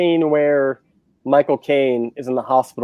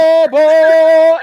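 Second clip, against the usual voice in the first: much higher, loud mock blast of music; blaring score imitation; 14 semitones higher, 8 dB louder than usual. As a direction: belt it like an overpowering film score. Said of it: "fucking [name]'s like".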